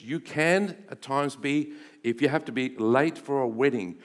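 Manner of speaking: ordinary delivery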